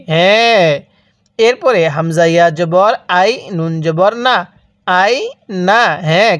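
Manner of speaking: ordinary talk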